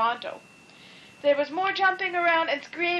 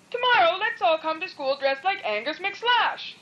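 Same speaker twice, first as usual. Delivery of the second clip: bright, raised eager voice; excited; 6 semitones higher than usual. A narrator's reading. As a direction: pitch the idea eagerly. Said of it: "added Millie".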